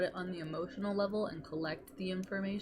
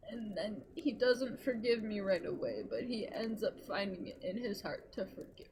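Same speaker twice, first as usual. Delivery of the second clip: ordinary delivery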